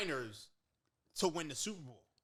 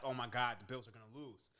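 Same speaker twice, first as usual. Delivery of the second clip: retold fear; 8 semitones lower, 7 dB quieter than usual. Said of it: "I got so fucking scared".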